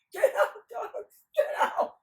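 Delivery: very high, loud yelling; hammed-up high-pitched shout; squealed command. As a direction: shriek at the dogs like a silly simpleton. Nasrudin copies the command shrieking.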